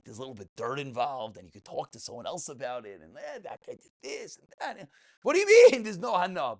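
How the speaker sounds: ordinary talk, nothing marked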